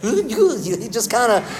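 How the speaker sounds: high, slow goofy dinosaur voice with a laugh; Barney voice. Shown as a demonstration. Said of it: "he kinda sounded like this".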